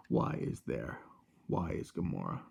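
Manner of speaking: low, whispered Thanos growl. Pretend. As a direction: drop into a low breathy villain voice. Thanos joke, "Where is Gamora.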